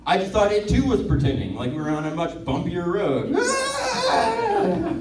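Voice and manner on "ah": mock startled cry. Bumpy road pretend.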